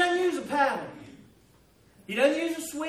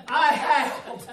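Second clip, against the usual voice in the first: laughing; 5 dB louder, slower than usual. Real, not acted. Laughing retelling the howl.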